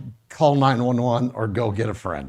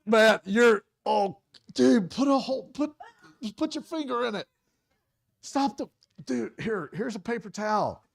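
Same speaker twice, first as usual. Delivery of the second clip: high frantic babble getting softer; fading panic; 7 semitones higher, 3 dB quieter than usual. Shown as a demonstration. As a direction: keep panicking but drop the volume. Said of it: "Stressed you out a little bit".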